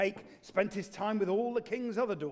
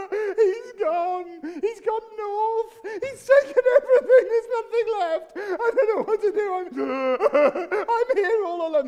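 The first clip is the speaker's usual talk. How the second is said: much higher, wailing, laugh-sobs breaking through; hammed-up fake sobbing; 12 semitones higher, 11 dB louder than usual. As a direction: overplay a girl's crocodile tears. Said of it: "Please, please, please, stop crying".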